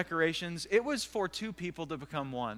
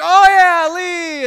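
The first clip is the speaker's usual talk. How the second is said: high, booming cover-up of a blunder; loud realization; 11 semitones higher, 17 dB louder, much slower than usual. Retold or imitated. Sudden loud realization before the crowd.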